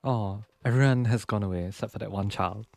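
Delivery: usual speaking voice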